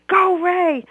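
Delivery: loud, high cheer; hollering. Acted. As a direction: yell it from the stands.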